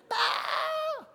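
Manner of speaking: very high fangirl squeal; imitated squeal of screaming girls; ear-piercing mock scream. Preacher imitating daughters at a Hannah Montana concert, piercing squeal. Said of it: "It would be ear-piercing".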